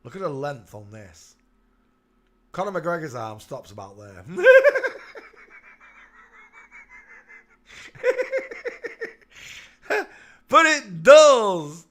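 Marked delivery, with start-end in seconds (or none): 4.3-10.4 big high burst of real laughter; can't stop laughing, loud and high; helpless cackling laugh; long laughing fit. Real, not acted. Long laugh at own joke. 10.5-11.8 laughing. Real, not acted. Still laughing.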